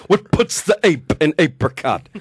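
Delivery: blustering cowardly lion voice; Bert Lahr. Retold or imitated. Comedian recreates a favorite movie scene, cowardly lion impression. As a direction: declaim it like the lion in Oz. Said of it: "it becomes the Bert Lahr show".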